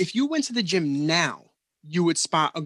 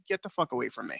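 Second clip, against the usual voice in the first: normal talking voice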